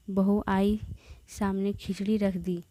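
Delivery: plain reading voice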